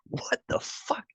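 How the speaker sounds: high, slow, breathy disbelief; incredulous. Retold or imitated. Hearing the cops' accusation, high slow disbelief. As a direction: stretch it out in total disbelief. Told he threatened the president.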